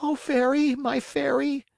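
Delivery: high, desperate plea; pleading puppet boy. Acted. Pinocchio begging the fairy, high and desperate. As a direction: beg the fairy.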